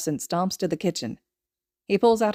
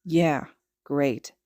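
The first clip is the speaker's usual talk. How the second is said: lower, flat-sounding reluctant agreement; low, unhappy; 4 semitones lower than usual. Acted. Kacchan answering his friend, low and unhappy. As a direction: mutter it without joy. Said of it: "His tone is low and he doesn't sound too happy".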